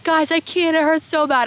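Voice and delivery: high, loud mock whine; imitated tearful plea. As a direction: plead in a hammed-up crying voice. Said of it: "I'm crying".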